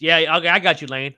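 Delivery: usual speaking voice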